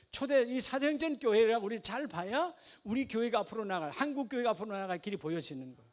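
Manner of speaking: ordinary talk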